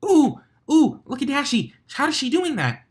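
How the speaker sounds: very high, loud excited ooh; squealing fan voice. Acted. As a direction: gasp with delight at the stunt. Pinkie excited at flyers.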